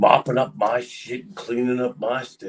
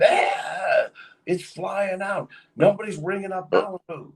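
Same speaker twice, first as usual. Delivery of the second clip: whiny complaining manager; 10 semitones higher than usual. Retold or imitated. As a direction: whine about the missing stock. Manager complaining about liquor costs.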